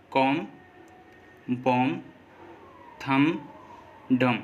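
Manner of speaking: कॉम, बॉम, थम, डम ending on M with no B; silent B. Shown as a demonstration. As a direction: close कॉम, बॉम, थम, डम on a plain M sound. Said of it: "तो वो silent रहेगा". (कॉम, बॉम, थम, डम के अंत में बी साइलेंट)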